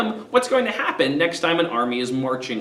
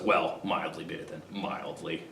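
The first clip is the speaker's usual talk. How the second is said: very low and soft, almost swallowed; grudging mildly; 12 semitones lower, 12 dB quieter than usual. Retold or imitated. Coriolanus conceding to his friend, barely audible. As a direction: give in under your breath. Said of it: "And Coriolanus goes".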